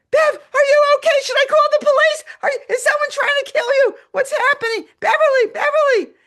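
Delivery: high, loud, frantic concern; alarmed doctor shouting. Retold or imitated. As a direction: call out urgently to someone in danger. Doctor alarmed over the phone.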